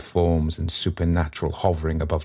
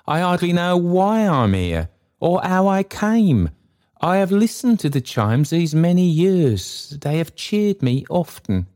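high-pitched, pleading character voice; high, earnest appeal to a superior; supplicating Trotty; 12 semitones higher than usual. Acted. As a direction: explain yourself humbly, voice pitched very high.

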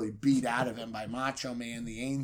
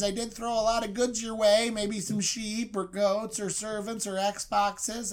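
high, wheedling pleading voice; high nervous bribe; 8 semitones higher, 3 dB louder than usual. Retold or imitated. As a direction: grovel and bargain fast. Jacob begs his brother.

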